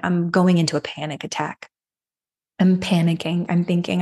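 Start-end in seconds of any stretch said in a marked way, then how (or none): none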